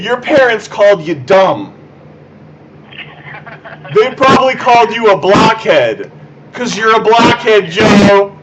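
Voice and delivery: high, loud screamed insults; screaming. Acted. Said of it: "because you're screaming very loud".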